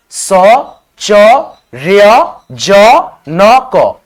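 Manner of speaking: each syllable स, च, र, ज, न, क said apart, slowly. Shown as a demonstration. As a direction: break the word into slow separate syllables. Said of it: "देखो आ".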